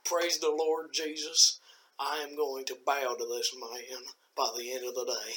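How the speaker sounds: slow, awestruck Southern judge; hammed-up Southern reverence; mock Southern judge. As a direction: proclaim it like a Southern churchgoer. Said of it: "Those Southern judges".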